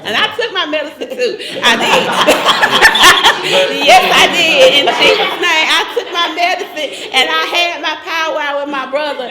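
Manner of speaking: loud, laughing through the words. Real, not acted. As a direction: giggle it out loud, tickled. Laughing about her medicine.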